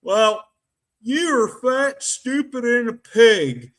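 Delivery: high put-on voice of a blunt TV advisor; Doctor Phil impression. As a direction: lampoon a TV doctor telling someone off. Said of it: "Doctor Phil's words of wisdom, folks".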